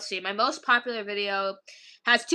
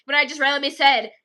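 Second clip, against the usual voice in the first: usual speaking voice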